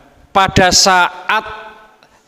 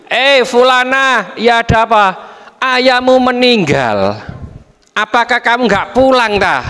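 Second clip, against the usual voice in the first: neighbour calling; 7 semitones higher than usual. Acted. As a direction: call up to her with the bad news. Neighbour calling with bad news. (tetangga memanggil dengan suara tinggi membawa kabar duka)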